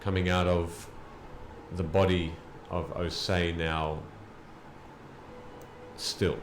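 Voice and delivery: slow, loud, weighty storytelling; dramatic narration. Acted. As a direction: narrate the fall, slow and heavy. GM narrates the battle's end.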